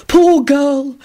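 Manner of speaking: high, pitying coo; sympathetic sigh. A narrator's reading. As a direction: pity her theatrically. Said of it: "sighed sympathetically".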